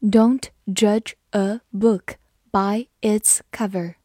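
slow, careful reading. Shown as a demonstration. Teacher reading the proverb slowly for students. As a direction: say each word slowly and clearly. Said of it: "好，我们慢读一遍。".

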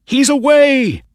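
high, loud shout of excitement; thrilled racegoer's yell; excited cry. A narrator's reading. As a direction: cry out as your horse breaks away. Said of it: "cried Mister Ross".

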